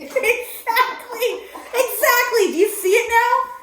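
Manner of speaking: high, loud laughing agreement; laughing hard. Real, not acted. Laughing with the host at herself, high and loud. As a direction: crack up while agreeing.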